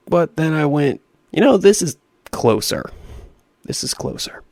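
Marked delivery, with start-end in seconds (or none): 3.6-4.4 unvoiced whisper, much softer; whispered aside. Gamer retelling his change of route, whispered aside. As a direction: repeat it under your breath. Repeats the remark under breath.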